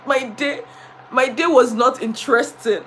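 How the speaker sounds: usual speaking voice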